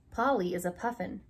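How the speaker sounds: ordinary delivery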